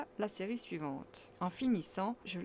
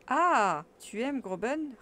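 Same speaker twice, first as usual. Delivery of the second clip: loud, high startled exclamation; shocked guardian demanding an answer; guardian's tone; 5 semitones higher, 8 dB louder, slower than usual. Acted. Uncle startled by his nephew's confession, loud and high. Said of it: "d'un véritable ton de tuteur".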